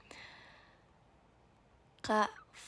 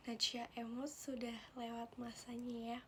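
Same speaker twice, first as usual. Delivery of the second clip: whisper; 9 dB quieter than usual. Real, not acted. Whispered aside.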